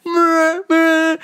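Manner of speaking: nasal mock car horn, meh meh; high mock horn blasts; honking noise. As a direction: imitate honking cars. Mimicking car horns honking.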